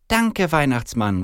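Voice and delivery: high, slow, delighted thanks; overjoyed little girl. A narrator's reading. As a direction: call out joyful thanks as a child. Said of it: "ruft sie freudestrahlend".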